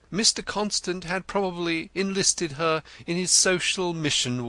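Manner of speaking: Denzil the poet. A narrator's reading. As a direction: play him florid and eager. Denzil answers Wimp.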